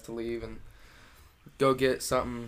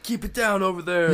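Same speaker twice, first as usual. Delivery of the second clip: high, loud fed-up neighbor; cranky neighbor; 8 semitones higher, 6 dB louder than usual. Retold or imitated. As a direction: shout it across the yard, annoyed. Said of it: "They're like".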